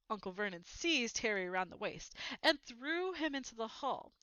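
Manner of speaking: ordinary delivery, nothing marked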